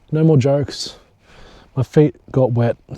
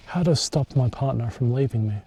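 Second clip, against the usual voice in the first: ordinary delivery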